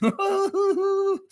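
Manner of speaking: sudden high burst of laughter. Real, not acted. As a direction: crack up at the joke. Laughing at a joke comment.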